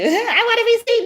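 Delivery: high, mocking sing-song; mocking quote. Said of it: "They try to say that about you".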